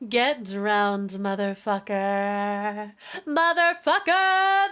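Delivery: slow, drawn-out gloating; gloating taunt. Pretend. Streamer as dragon drowning a foe, slow gloating taunt. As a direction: stretch each word, then rise on the repeat.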